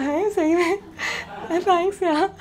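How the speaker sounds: high, loud delighted squeal; light up, thrilled; delighted surprise. Retold or imitated. Delighted girl. (खुशी से ऊँची, ज़ोरदार आवाज़)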